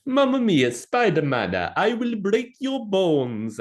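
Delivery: over-the-top Italian villain threatening Spider-Man; Italian accent. Pretend. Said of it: "do an Italian accent. Go".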